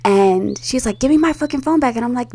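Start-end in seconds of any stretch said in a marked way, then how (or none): 1.0-2.0 high-pitched mock demand; imitated angry demand. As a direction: demand it back like an angry sister. Sister demands phone, acted out.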